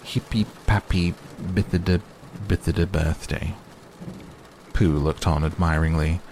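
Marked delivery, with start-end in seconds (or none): 0.0-3.5 Hippy Pappy Bithida Bithida Birthday read as misspelt nonsense; a lightly voiced reading of Owl's wobbly writing; garbled spelling read out. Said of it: "this is what he wrote".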